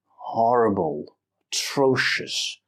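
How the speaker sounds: slow, heavy stress on "Horrible" and "atrocious"; exaggerated stress. Shown as a demonstration. Teacher repeating the words with heavy stress. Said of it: "I was exaggerating the stress syllables".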